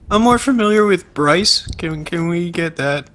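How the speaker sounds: high, timid nerdy voice; mock intern voice. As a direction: ask sheepishly like a newbie. Mocking a clueless intern.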